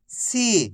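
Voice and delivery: सी with the ī held long, then falling; drawn-out long ī. Shown as a demonstration. Teacher modelling सा plus matra for class, drawn out. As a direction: stretch out सी. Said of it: "खींच खींच के इसका उच्चारण करना है".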